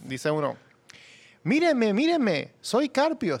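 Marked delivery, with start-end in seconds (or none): 1.4-3.4 high taunting read; mocking tweet voice. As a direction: mimic a show-off student. Lee tweet burlón imitando. (lectura burlona con voz aguda)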